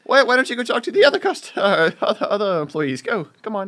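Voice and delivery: high, loud, urgent shoo-away voice; mock shooing. Friend plays worker shooing off angry customer, high and loud.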